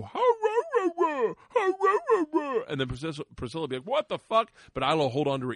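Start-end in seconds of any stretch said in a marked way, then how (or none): 0.0-2.6 high, loud mock roaring; goofy sing-out roar, right in the face; imitating kid roaring at dog. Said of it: "will get in her face and go". 3.9-4.5 high, loud mock outrage; startled dog's reaction. Voicing the startled dog.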